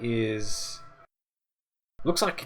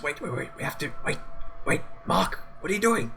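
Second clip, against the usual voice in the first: urgent calling; 5 semitones higher than usual. Acted. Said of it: "so Alphonse says".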